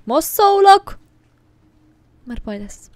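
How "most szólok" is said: "Most szólok!" yelled high and loud; shouted warning. Real, not acted. (hangos, magas kiáltás)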